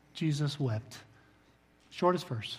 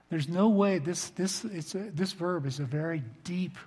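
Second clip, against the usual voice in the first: ordinary talk, nothing marked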